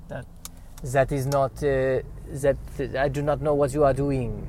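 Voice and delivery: halting, put-on French accent. Frenchman giving up on the Dutchman, French accent. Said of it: "It makes my French accent look good".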